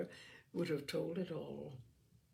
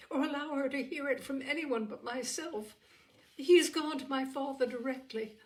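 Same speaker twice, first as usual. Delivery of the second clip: higher, brighter and louder with joy; joyful outcry; 6 semitones higher, 5 dB louder than usual. Acted. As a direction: gush out the happy news to your sister. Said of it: "she cried".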